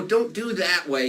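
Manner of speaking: usual speaking voice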